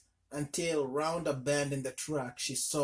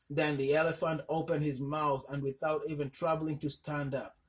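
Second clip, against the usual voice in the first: usual speaking voice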